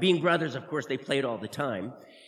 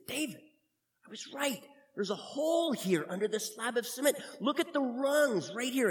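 hushed excitement; 8 semitones higher than usual. Acted. Discovering hole, hushed excitement.

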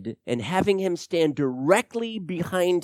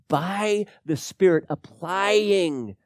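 usual speaking voice

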